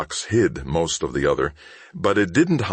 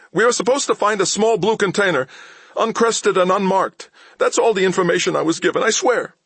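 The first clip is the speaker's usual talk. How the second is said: high, louder hurried confession; frightened thief; 4 dB louder than usual. A narrator's reading. Thief confessing to Mal, high and frightened. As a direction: blurt it all out to be spared.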